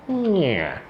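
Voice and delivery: high nasal creak sound of a door; door creak imitation. Shown as a demonstration. Host demonstrating the door sound effect, creaking. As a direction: make the door's creak with the mouth. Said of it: "quer ver? Ó".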